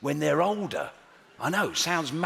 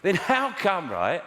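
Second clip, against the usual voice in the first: high, mocking sarcastic challenge; incredulous, scornful cross-examination, pitched up; heckler put-down; 6 semitones higher than usual. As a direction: take a child down like a heckler. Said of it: "treating the kids like hecklers".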